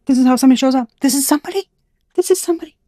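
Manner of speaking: high, loud, thrilled exclaiming; excited. Real, not acted. Vlogger spotting someone in the cards, high and excited. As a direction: point it out with delight.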